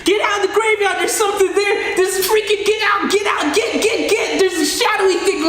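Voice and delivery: high, frantic shout to get out; panicked yelling. Retold or imitated. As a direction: scream a warning across the graveyard. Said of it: "I'm yelling".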